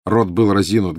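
usual speaking voice

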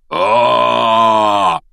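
long, loud howl of pain; roar of pain. A narrator's reading. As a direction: let out one drawn-out, loud cry of pain. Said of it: "вдруг взревел Хагрид". (протяжный рёв от боли)

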